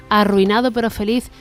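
ordinary delivery

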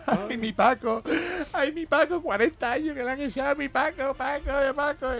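high, tearful wail of a wife. Acted. Wife sobbing to her jailed husband Paco, high tearful wail. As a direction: cry out your husband's name in grief. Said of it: "lo abrazó llorando".

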